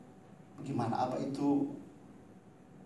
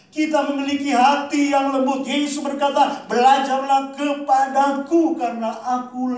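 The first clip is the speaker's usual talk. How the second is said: much higher and louder, peaking on Tuhan; climactic preaching; 9 semitones higher, 11 dB louder than usual. Acted. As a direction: build to a crying-out climax. Climax of sermon.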